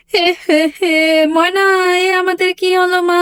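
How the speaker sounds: mother wails over the stolen machine; sobbing lament. Acted. Mother sobbing to daughter Moyna over the theft. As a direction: cry out the loss through sobs. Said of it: "কান্নাকাটি এভাবে তুমি করিও না".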